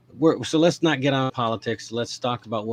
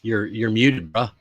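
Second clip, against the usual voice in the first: usual speaking voice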